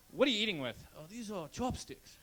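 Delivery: lightly put-on Japanese accent, mocking; a hint of a caricatured Japanese accent. Comic voicing a Japanese guy in a mock Japanese accent. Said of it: "I did kind of a racist accent".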